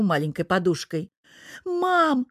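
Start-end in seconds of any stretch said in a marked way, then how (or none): 1.6-2.3 very high whiny call to mother; high girlish complaint; complaining. A narrator's reading. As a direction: whine for mum's support. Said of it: "начала жаловаться Тереза".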